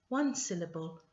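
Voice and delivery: ordinary delivery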